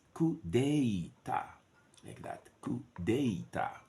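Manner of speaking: coup d'etat drawn out slowly, ending on a clear A; careful model. Shown as a demonstration. Teacher models the French ending of coup d'etat, slow careful pronunciation. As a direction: spell out coup d'etat syllable by syllable. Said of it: "is A".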